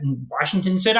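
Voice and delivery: normal talking voice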